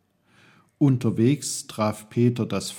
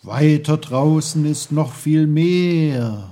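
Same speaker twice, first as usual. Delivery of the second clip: louder, booming sea voice; the sea roars at little Peter, loud; roaring sea; 5 semitones higher, 8 dB louder than usual. Acted. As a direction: boom it out like crashing waves. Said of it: "brauste das Meer".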